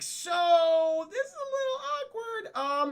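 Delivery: very high, strained sing-out; awkward confession. Retold or imitated. Brooklyn breaking the news to the gargoyles, awkward.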